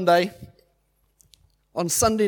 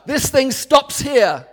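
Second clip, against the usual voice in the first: firm declaration; 5 semitones higher, 6 dB louder, much slower than usual. Retold or imitated. Taking authority over illness.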